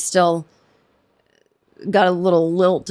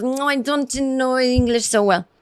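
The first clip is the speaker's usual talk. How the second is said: mock Spanish accent; 4 semitones higher than usual. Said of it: "that extra level of".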